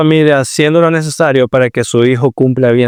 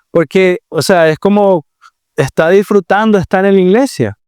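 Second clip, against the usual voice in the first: ordinary talk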